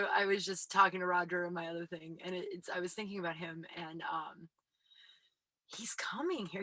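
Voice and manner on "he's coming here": whisper. Acted. Teasing aside about Roger.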